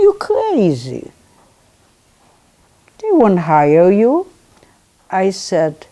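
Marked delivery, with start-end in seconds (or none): 0.0-4.3 higher, louder, slow scoffing; brother's dismissive scoff. Retold or imitated. Brother scoffing at her plan to work at Klein's. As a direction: brush her off as foolish.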